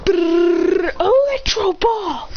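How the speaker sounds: rolled charge-up, then high stretched call; trill, then cry out the move; hammed-up anime battle cry; attack call. Hero charges and calls attack.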